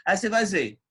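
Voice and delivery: ordinary delivery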